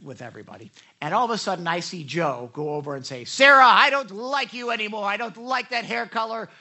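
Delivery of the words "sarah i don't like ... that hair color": louder, higher hammed-up insult; play-acted public telling-off; mock confrontation. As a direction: loudly tell someone off, hammed up. Acting out an insult to Sarah.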